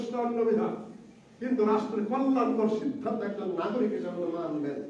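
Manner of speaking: normal talking voice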